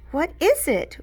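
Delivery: high, eager question; bouncing with excitement; eager squeal. A narrator's reading. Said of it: "Chloe squealed".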